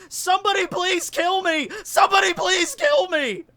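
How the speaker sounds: high, loud panicked shouting; desperate yelling. Retold or imitated. Dream self yelling at survivors, panicked shouting. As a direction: beg everyone to end it, screaming. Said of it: "running around to all the other survivors like".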